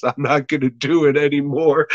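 ordinary talk

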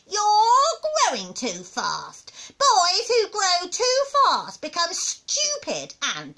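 loud, slow, high scolding; high, overbearing granny; cantankerous old grandma. Acted. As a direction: lecture a child with drawn-out disdain.

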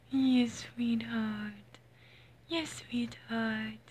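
soft slow whisper to the cat; slow tender whisper; whispered cooing. Real, not acted. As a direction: breathe it tenderly to a pet.

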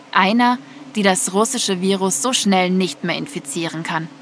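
plain reading voice

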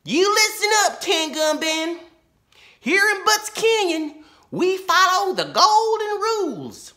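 put-on very high, loud, slow girl sheriff; loud and firm. A narrator's reading. Laura lays down the rules to the outlaw, loud and slow. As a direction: lay down the law. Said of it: "said Law and Order loudly".

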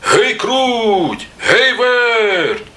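high, drawn-out call with falling tail; rooster calling out. A narrator's reading. Rooster back home summons the mice, calling out. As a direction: holler the names long and high. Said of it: "Знов кличе мишенят".

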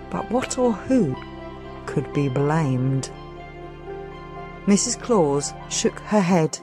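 plain reading voice

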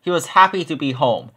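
ordinary delivery, nothing marked

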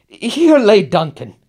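high, loud cry of grief; high anguished exclamation; anguished outburst. Acted. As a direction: burst out at the memory. Passionate speech over the corpse.

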